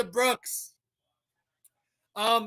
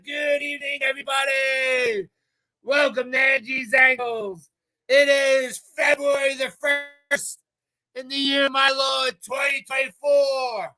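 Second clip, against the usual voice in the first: show announcer; 4 semitones higher than usual. Acted. Host opening his live show to viewers, announcer call. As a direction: welcome the viewers like a big announcer.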